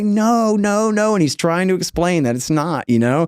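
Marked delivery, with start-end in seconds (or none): 0.0-1.1 imitating protest. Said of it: "And he's like".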